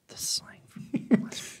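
whispered under the breath; whispering. Real, not acted. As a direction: whisper it to yourself. Repeating a phrase under his breath.

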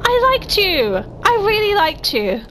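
high, drawn-out whine of hurt; betrayed pleading. Real, not acted. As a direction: lament it, let down by a favorite. Feels betrayed by Axel.

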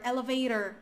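"elevator" with tapped t and r-coloured ending; American pronunciation of "elevator"; American accent. Shown as a demonstration. Teacher's example of the middle T, American accent. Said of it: "é o americano".

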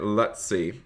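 normal talking voice

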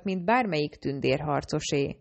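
usual speaking voice

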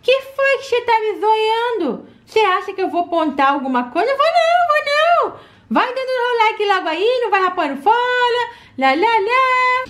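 put-on high, playful child voice; cheeky little girl. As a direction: tease the viewer like a cheeky kid. Doll teasing the viewer.

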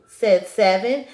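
plain reading voice

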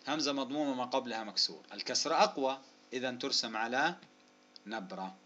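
normal talking voice

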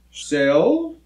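Syl-la-bo split into three separate beats; long pause between each part of Syl-la-bo; syllable by syllable. Shown as a demonstration. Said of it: "figure out how many syllables".

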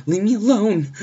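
very high, loud terrified yell; scream. Acted. Terrified babysitter answering the phone again, screaming. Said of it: "she screams".

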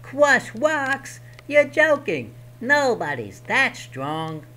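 small goblin laying down the law; first goblin. Acted. Goblin blocking Herschel's candles, high and bossy. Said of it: "The goblin laughed".

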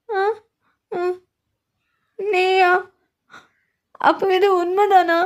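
tearful, wounded complaint to her late hero; crying and hurt. Acted. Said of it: "சரி, அழாத".

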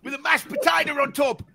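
hammed-up South African, laughing through it; a laughing South African caricature; mock South African accent. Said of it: "I'm starting to get South African now".

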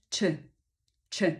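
czy said as a short, quick burst; quick T sound. Shown as a demonstration. Teacher models the T of Tuesday, quick czy. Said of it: "T brzmi jak szybkie".

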